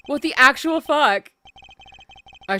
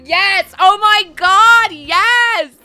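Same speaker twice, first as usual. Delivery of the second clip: high, loud, thrilled yes; excited squeal; 7 semitones higher, 8 dB louder, much slower than usual. Real, not acted. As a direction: squeal with delight.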